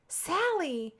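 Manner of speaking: a high, scandalised cry of her name; mock indignant. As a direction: pretend to be shocked at the tease. Friend teased about a crush.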